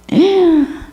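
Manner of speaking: sharp high gasp of surprise; startled gasp. Acted. Preacher acts out finding bugs under the mat, startled gasp. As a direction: gasp as if you've just found something.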